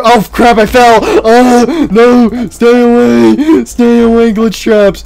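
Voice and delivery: high, loud frightened yells; terrified yelling. Real, not acted. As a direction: yell them away in terror. Fallen, clones approaching.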